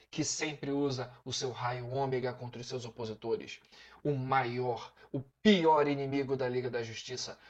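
grand introduction, drawing out the name; dramatic announcer intro. Acted. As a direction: build up each name like a show host.

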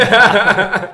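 loud laughing confession. Real, not acted.

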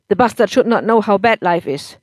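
put-on loud, bitter drunk husband; drunk angry husband. A narrator's reading.

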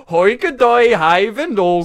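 loud Dutch-sounding gibberish; exaggerated pretend Dutch accent; mock Dutch accent. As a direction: belt out fake Dutch. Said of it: "So it sounds like Dutch".

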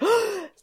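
high, sudden gasp; sharp intake of delighted surprise; excited gasp. Retold or imitated. Fan retelling hearing the first line, excited gasp.

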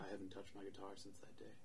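soft whisper, barely voiced; whispered confession. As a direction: confess it under your breath. [name]'s sad quiet confession.

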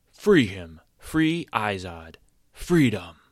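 loud, slow chant of the words; scream. A narrator's reading. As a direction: yell each cry out. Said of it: "Now stand on me and scream".

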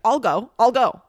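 loud, eager volunteering; mock-eager roommate; imitated eagerness.

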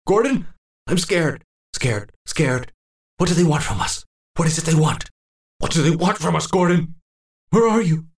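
high, desperate pleading; frightened old man searching for his friend; terrified calling. Acted. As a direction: call out for Gordon in rising panic.

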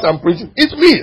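ordinary talk, nothing marked

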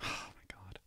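sighs it out in a breathless whisper; a tired whispered oh my God. Real, not acted. Sighing at the memory.

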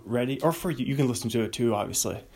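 ordinary talk, nothing marked

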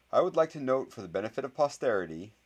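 clear voice. A narrator's reading. Boy addresses the watching room after the deadline, in a clear voice. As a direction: state it clearly for the record. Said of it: "in a clear voice".

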